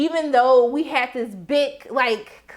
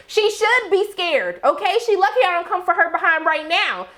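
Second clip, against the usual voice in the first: heated rant; 8 semitones higher than usual. Real, not acted. Ranting at character on screen.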